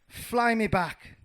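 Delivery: high, loud, stretched-out order; urgent command. Acted. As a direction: call it out slowly and insistently. Mouse urgently orders the bird.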